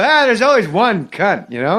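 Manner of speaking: high, loud, stretched-out comeback; Norm Macdonald impression. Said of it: "I did it in the impression".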